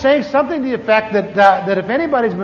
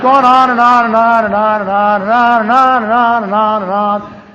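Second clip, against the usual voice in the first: going on and on; 5 dB louder than usual. Retold or imitated. Droning service that goes on and on.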